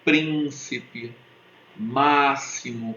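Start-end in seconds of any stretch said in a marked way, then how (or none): 1.8-2.9 "máximo" slowly, stress on "má"; "máximo" drawn out, leaning on "má"; dragged proparoxytone. Shown as a demonstration. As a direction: stretch out "máximo" with weight on the first syllable. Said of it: "tem uma tônica e duas átonas".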